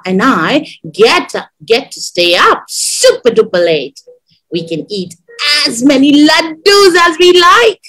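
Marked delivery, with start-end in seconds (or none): 5.7-7.8 higher, excited rise; delighted, higher emphasis; gleeful child. A narrator's reading. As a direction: relish it like a thrilled child. Child delighted by wedding treats.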